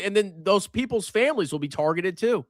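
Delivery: usual speaking voice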